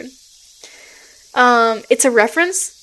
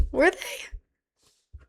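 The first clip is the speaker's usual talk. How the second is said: scared; 4 semitones higher, 9 dB quieter than usual. Real, not acted. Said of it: "I'm scared".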